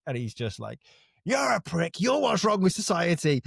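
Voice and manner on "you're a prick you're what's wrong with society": high mocking insult; mock angry bloke. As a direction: sneer it like the customer. Customer insulting, voiced high.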